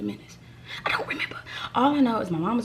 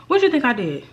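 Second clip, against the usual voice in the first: laughing; 6 semitones higher, 4 dB louder than usual. Real, not acted. Laughing at own mistake.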